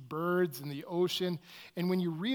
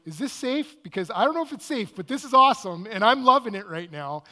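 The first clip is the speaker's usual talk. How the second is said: loud, high, half-scared delight; nervous thrill; 4 semitones higher, 10 dB louder than usual. Retold or imitated. Peter in the dinghy as whales approach, loudly thrilled. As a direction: gasp out fear and joy together.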